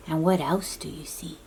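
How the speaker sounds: ordinary delivery, nothing marked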